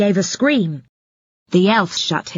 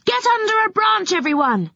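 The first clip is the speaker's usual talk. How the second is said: urgent warning; 14 semitones higher than usual. Acted. Warning everyone to take cover.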